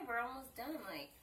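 usual speaking voice

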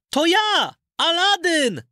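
put-on high, bright boyish voice calling out at the doors; a young lad announcing himself to the door; young Aladyn calling. Aladyn calls out his name at the golden door, high boyish voice. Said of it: "Aladyn znów zawołał".

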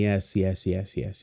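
usual speaking voice

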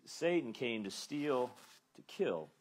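ordinary talk, nothing marked

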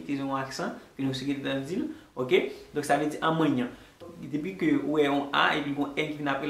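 usual speaking voice

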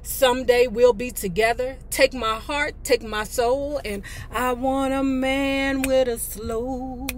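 usual speaking voice

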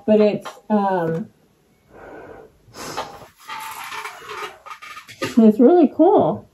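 muffled inside full mask; speaking inside snorkel mask; through a face mask. Real, not acted. Seen 1: Full face snorkel mask covers mouth and nose throughout the words.

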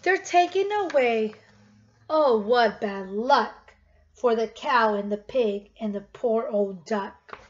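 normal talking voice

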